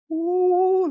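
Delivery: surprised ooh. Real, not acted. Surprise at the heel lift.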